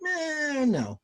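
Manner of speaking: high drawn-out "eh", dropping to a casual no; mock a lukewarm brush-off; dismissive publisher. Publisher turning down a creator's idea, dismissive. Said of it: "they're like".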